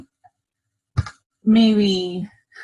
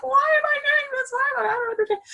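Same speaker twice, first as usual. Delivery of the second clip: much higher, crying voice; retold crying; 3 dB quieter, much faster than usual. Retelling her breakdown to the group, high crying wail. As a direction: wail it like you are about to break down. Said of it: "I was like".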